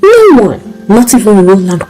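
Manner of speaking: plain reading voice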